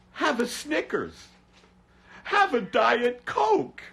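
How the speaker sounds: high mock pitchman. Mocking ads to his viewers, high sing-song pitchman voice. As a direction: parody a cheerful advert, way up high. Mocking society's cultural advice.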